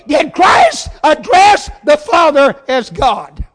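loud, shouted sermon delivery; full-force preaching. Real, not acted. Preacher telling the church what worship is, full-force preaching.

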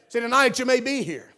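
usual speaking voice

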